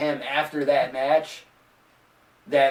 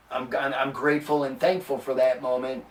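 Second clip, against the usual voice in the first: normal talking voice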